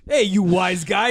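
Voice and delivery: loud, brash New York wise guy; hammed-up tough-guy comic impression; Andrew Dice Clay. Bad Dudley Moore calling out wise guys, loud New York tough-guy voice. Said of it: "I love Andrew Dice Moore".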